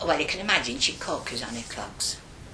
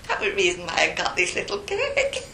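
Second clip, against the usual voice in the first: usual speaking voice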